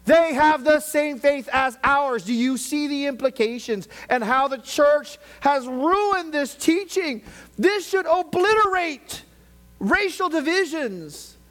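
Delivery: high, very loud impassioned shouting; full-force preaching. Real, not acted. Preacher condemning racial divisions to his church, full-force shouted preaching. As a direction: thunder it at the congregation, stretching the final declarations.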